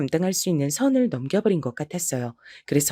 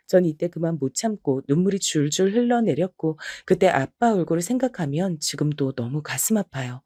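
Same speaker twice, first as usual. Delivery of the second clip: usual speaking voice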